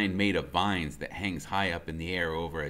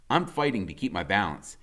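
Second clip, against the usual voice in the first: ordinary delivery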